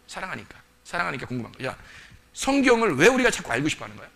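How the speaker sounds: normal talking voice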